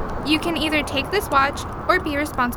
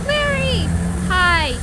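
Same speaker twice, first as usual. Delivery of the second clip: excited greeting; 3 semitones higher, 3 dB louder, slower than usual. Acted. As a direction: hail him eagerly mid-chase. Greets Larry while fleeing.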